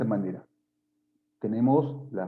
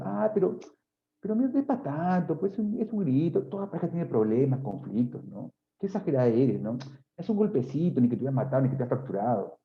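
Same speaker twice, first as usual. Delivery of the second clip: minimizing aggressor; 3 semitones higher than usual. Retold or imitated. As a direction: brush it off like it was nothing. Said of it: "O muchas minimizan".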